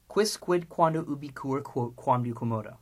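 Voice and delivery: brisk run-through of the list, stepping down in pitch; quick recitation. Shown as a demonstration. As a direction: rattle off the Latin list. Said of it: "Now, three times quickly".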